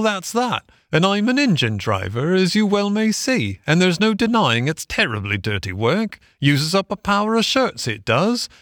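high, sing-song workman's voice; good engine driver. A narrator's reading. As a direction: strike a kindly bargain, pitch swooping up. Engine driver makes offer.

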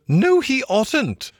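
high, drawn-out Mole cry; shouted interruption. A narrator's reading. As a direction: cut in high and indignant. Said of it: "shouted the mole with his mouth full".

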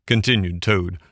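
usual speaking voice

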